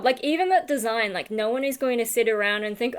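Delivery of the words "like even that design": usual speaking voice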